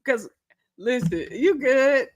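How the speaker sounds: normal talking voice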